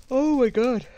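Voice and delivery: high, loud astonished cry; shocked. Real, not acted. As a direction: blurt it out stunned. Shocked reaction after gasp.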